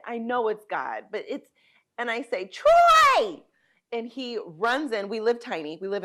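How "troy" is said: full desperate yell, far higher and louder; yelling his name. Retold or imitated. Desperate wife yelling for her husband in the night. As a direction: scream the name with everything left. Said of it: "Yell his name".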